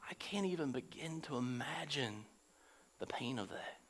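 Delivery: whispered empathy; hushed whisper. Real, not acted. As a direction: breathe it out in awe of the pain. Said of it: "whispered then repeated aloud".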